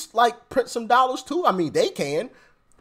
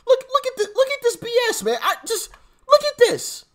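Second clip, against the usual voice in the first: much higher, disbelieving look at this; incredulous squeal. Real, not acted. Reacting to Letitia James's wealth, high incredulous squeal.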